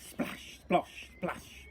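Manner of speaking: plain reading voice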